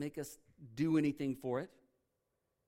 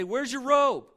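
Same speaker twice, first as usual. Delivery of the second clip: a higher, louder mock scolding of a son; imitated angry dad scolding his returning son, louder and higher; 7 semitones higher, 8 dB louder than usual. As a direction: bark the questions like an exasperated father. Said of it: "his dad didn't say".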